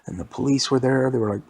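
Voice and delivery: plain reading voice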